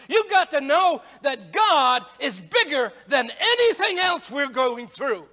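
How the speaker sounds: forceful pulpit proclamation, key words punched; emphatic preaching. Real, not acted. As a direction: drive the assurance home to the congregation.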